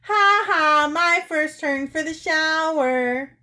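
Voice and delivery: high, loud gloating sing-out; slow, taunting high shout; triumphant shout. Acted. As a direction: crow it at full voice, drawn out. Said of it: "shouting at the top of his voice".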